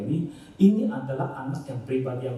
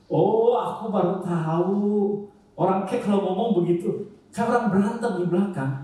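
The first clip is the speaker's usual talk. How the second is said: menirukan anak yang baru sadar, nada lebih tinggi; anak baru paham; 7 semitones higher, 4 dB louder than usual. Retold or imitated. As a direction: tirukan kekagetan anak dengan suara naik. Child's sudden realization, retold by parent. (menirukan anak yang baru tahu)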